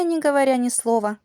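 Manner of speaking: ordinary delivery, nothing marked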